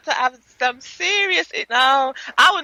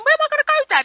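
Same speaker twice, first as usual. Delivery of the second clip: high-pitched plea to Dad; protesting son; 11 semitones higher, much faster than usual. Retold or imitated. Retold son being thrown out.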